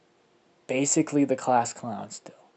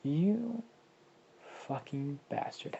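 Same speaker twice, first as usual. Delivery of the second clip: high start then dropping much softer; fond jab at him before the kiss; teasing Hinata insult; 3 semitones higher, 8 dB quieter, slower than usual. Acted. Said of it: "as saying".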